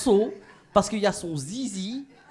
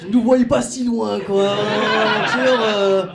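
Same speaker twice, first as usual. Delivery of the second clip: mock flustered boyfriend; 5 dB louder, slower than usual. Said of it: "Il a réagi en mode".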